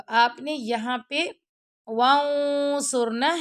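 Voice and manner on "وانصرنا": وانصرنا with a long, heavy nasal hum before ص; heavy ikhfa. Shown as a demonstration. Teacher showing students ikhfa, وانصرنا with heavy held nasal. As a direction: hold the hidden n of وانصرنا thickly. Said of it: "heavy اخفا کرنا ہے".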